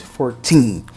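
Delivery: put-on FOURTEEN with slow, stressed "teen". Shown as a demonstration. Teacher summing up the contrast, stress on TEEN in fourteen. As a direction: land on the end of FOURTEEN. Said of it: "tem que ser dada ênfase".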